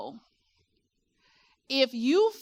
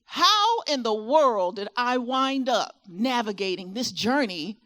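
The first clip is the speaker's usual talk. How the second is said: normal talking voice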